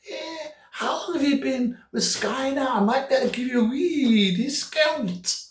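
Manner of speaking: high, put-on Scottish woman; chatty phone operator with a hammed-up Scottish accent; broad Scottish accent. Sky operator makes small talk with caller, broad Scottish accent. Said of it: "She had a, a broad Scottish accent".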